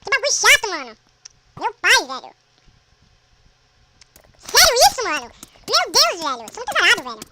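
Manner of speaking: very high, very loud raging tirade; furious rant. Real, not acted. Gamer dies again and screams at his bad luck. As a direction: scream every line at the game.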